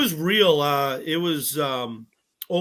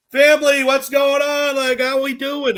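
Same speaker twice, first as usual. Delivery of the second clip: big, high, booming hello to the whole room; bubbly loud greeting; 9 semitones higher, 8 dB louder than usual. Retold or imitated. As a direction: call out like greeting the whole family.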